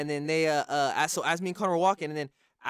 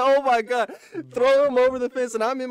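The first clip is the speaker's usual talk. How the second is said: louder and higher, caught up in the panic; alarmed exclaim; 6 semitones higher, 8 dB louder than usual. Retold or imitated. Retelling the robbery to his friend, loud alarmed disbelief. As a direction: relive the moment the third guy appeared. Third guy jumps out of trunk.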